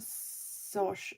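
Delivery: Sosh with a rounded "o", softly; rejected pronunciation. Shown as a demonstration. Testing the wrong name aloud, Sosh said softly. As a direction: quietly try Sosh with an "aw" vowel. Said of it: "not Sosh".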